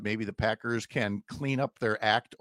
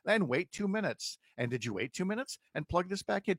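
robot voice; 6 semitones higher, 3 dB quieter than usual. Pretend. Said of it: "you first have to chat with a robot".

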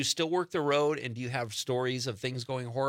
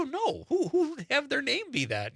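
much higher, disbelieving squeak; high incredulous; 13 semitones higher than usual. Retold or imitated. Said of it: "I go".

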